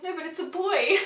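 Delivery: high, loud giddy insistence; insist, giddy with joy; excited insistence. Retold or imitated. Telling husband Chris again, giddy insistence. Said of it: "I'm like".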